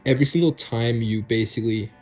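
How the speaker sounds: ordinary delivery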